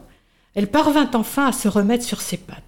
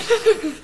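mocking laugh; 10 semitones higher than usual. Insects laugh at caterpillar.

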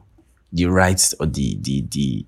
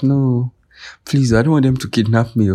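higher, louder mock plea; mock scared friend; 7 semitones higher, 4 dB louder than usual. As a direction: whine it like a frightened girl. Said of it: "She was like".

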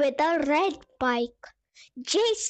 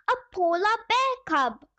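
higher, excited exclamation; excited cry; 6 semitones higher than usual. Acted. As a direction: cry out in delight. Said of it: "cried Pip".

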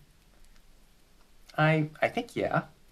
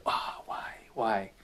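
whispered plea; 7 dB quieter, slower than usual. Retold or imitated. Imitating a desperate night prayer.

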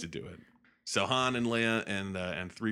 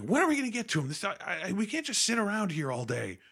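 high-pitched mock-impatient outburst; angry impatience; 13 semitones higher than usual. Luke throwing down his soup, impatient anger. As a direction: whine in angry frustration at waiting. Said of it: "in anger".

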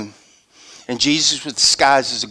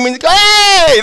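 loud, high, drawn-out greeting; delighted greeting; 9 dB louder than usual. Retold or imitated. Old friends hadn't seen each other, excited acted greeting. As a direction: whoop a long hello to a long-lost friend.

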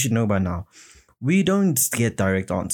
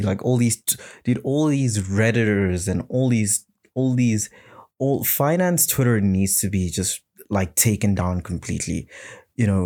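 ordinary delivery

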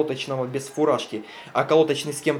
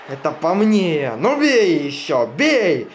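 wounded defiance; 3 semitones higher, 6 dB louder, much slower than usual. Acted. Wounded worker defying the volley.

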